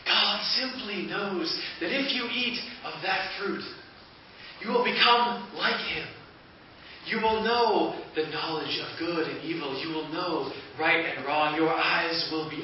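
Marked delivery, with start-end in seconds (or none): 0.1-9.4 serpent persuading. Acted. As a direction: sell the lie with light insistence. Serpent contradicts Eve.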